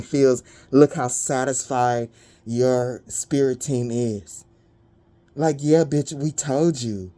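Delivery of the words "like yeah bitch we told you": ordinary talk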